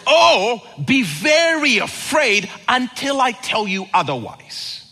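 high, loud teasing angel; mock angel. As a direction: ham up a menacing angel.

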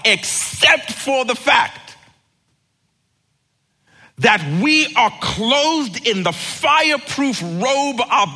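full force preaching. Real, not acted. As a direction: preach it home.

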